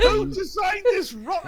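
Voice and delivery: laughing disbelief. Real, not acted. Mocking incredulous question, laughing.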